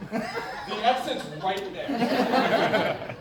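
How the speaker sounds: slow, laughing warning. Real, not acted. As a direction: drag it out through laughter.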